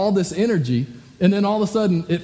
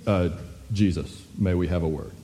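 low, soft, discreet murmur; imitating solemn officials, low and hushed; hushed approach; 11 semitones lower, 5 dB quieter than usual. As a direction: quietly ask for a private word, pitched well down. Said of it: "they walk up very quietly".

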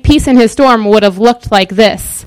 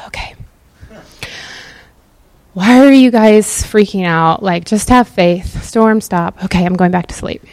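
calm sleepy Jesus. Retold or imitated. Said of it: "this is just my version of it".

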